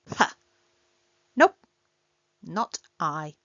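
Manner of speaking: ordinary delivery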